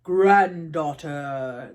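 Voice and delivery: an ancient grandfather calling; strong old man. Acted. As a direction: speak as a powerful old spirit. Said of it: "the old man said".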